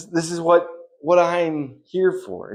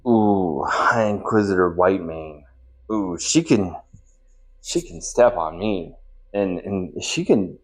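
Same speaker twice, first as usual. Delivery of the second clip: low, lingering admiring voice; swooning admiration; 8 semitones lower, slower than usual. Acted. Swooning over Whitemane, low and lingering. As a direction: drop low and linger, smitten.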